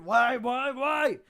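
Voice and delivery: loud, high wailing orc; pained orc. Acted. Orc covering his ringing ear, high loud wail. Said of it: "covers his ear a bit".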